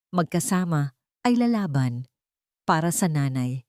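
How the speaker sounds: plain reading voice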